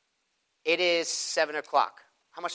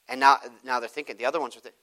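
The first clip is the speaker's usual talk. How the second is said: ordinary talk, nothing marked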